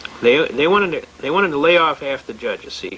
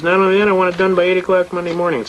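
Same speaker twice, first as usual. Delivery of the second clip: higher, fast retold order; quick, raised-pitch mock command from the bench; demanding judge; 4 semitones higher, faster than usual. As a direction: imitate a judge piling on a demand. Acts out judge ordering the prosecutor.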